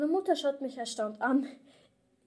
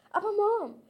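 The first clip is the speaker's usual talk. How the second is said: whiny protest; 8 semitones higher, 4 dB louder than usual. Acted. Kid protesting.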